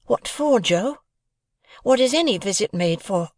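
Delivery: put-on a young apprentice asking earnestly; young Pip. A narrator's reading. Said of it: "Pip presses Joe".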